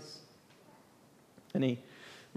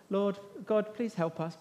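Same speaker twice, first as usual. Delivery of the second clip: timid plea; 9 dB quieter than usual. Pretend. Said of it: "It's not just even like they're just".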